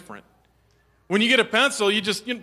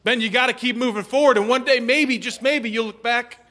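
urgent encouragement; 6 semitones higher, 5 dB louder than usual. Retold or imitated. Teacher urging, builds loud.

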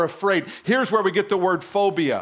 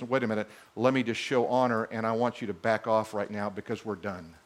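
much lower and softer, quietly firm; low soft God voice; 10 semitones lower, 8 dB quieter than usual. Acted. God telling the wind to back off, low and soft. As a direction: say it low and final.

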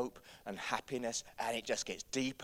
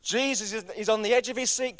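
high, loud, driving sermon voice; rally the congregation at full voice; full-force preaching; 11 semitones higher, 13 dB louder than usual. Real, not acted.

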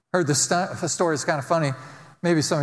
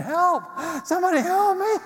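high-pitched mock scream for help; frantic falsetto pleading, acted out for laughs; panicked screaming; 14 semitones higher than usual. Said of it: "he starts screaming".

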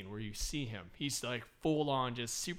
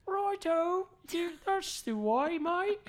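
high, hammed-up British accent, slow; cheery mock British bloke; 10 dB louder, much slower than usual. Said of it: "I could do a British accent".

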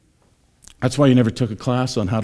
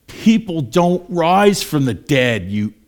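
slow, higher and louder scoffing; mocking disbelief; 6 semitones higher, 5 dB louder, much slower than usual. Street mocker answering the preacher, mocking disbelief. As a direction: scoff as if it's plainly absurd. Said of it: "I've actually had people say".

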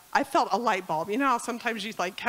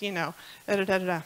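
normal talking voice

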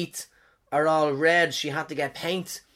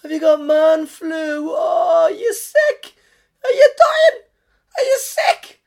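shrill-free but much higher and louder mock sympathy, slow and wailing; mocking pharmacist; 12 semitones higher, 10 dB louder, slower than usual. Pharmacist mocking his man flu, high wailing pity. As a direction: ham up a pitying chemist cooing over man flu. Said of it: "she started going".

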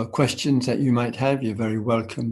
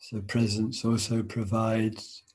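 guided meditation. Acted. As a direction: ease them into stillness, unhurried.

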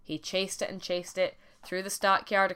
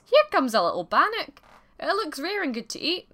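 loud, high-pitched astonishment; surprised cry; 9 semitones higher, 7 dB louder than usual. A narrator's reading. Man tells his wife a bannock is coming, surprised cry. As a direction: announce it in delighted surprise, voice leaping up. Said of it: "cried out in surprise".

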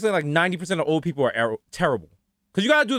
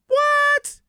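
very high-pitched disbelief; falsetto-high startled question; mock shock. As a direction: squeal it in exaggerated shock. Shocked reaction to machete detail.

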